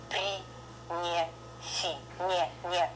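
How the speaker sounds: при, не, си palatalized, one at a time; tense the mouth for soft не; very soft consonants. Shown as a demonstration. Teacher shows how tensed muscles soften it, soft syllables. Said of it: "very soft, compared to".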